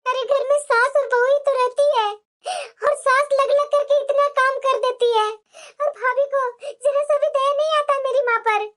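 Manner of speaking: ordinary talk, nothing marked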